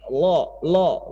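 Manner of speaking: "lo, lo" with a light tap, near an l; almost like l. Shown as a demonstration. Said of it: "Kayak bunyi". (bunyi mirip lo)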